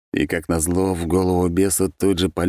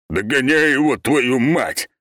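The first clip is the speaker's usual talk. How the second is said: loud, higher furious yell; barked order; 8 semitones higher, 4 dB louder, slower than usual. Acted. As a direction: roar at him to run. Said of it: "басом гаркнул бес". (рявкнуть)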